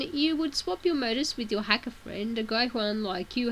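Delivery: ordinary delivery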